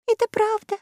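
high, timid little squeak; timid, high-pitched and quavering; trembling voice. A narrator's reading. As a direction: confirm it in a small high, trembling voice. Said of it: "дрожащим голосом подтвердила Заури".

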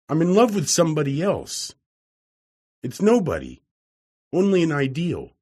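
a hint of a gentle young woman turning him down; Gladys, gently voiced. A narrator's reading.

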